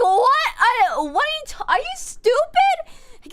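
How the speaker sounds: high, loud, stretched outraged disbelief; retold disbelief. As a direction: shriek the "what" and drag out "stupid". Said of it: "And I'm like".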